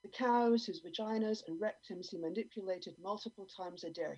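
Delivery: usual speaking voice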